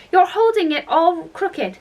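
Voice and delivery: high-pitched, urgent warning; crying out. A narrator's reading. Alice sees the Queen clutch the brooch, warning urgently. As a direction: keep the alarm up in pitch. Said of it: "cried Alice".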